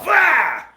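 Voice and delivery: sudden high fight cry; explosive action-movie strike shout; imitated kick yell. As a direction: burst out with a mock martial-arts yell.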